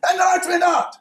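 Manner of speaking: high, loud commentator cry of "lights went out"; rugby commentator shout. Shown as a demonstration. Evaluator demonstrating voice modulation, shouting like a rugby commentator. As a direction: burst out like a sports announcer. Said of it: "you're watching the, the game of rugby and saying".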